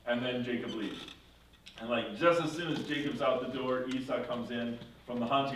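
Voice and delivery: ordinary delivery, nothing marked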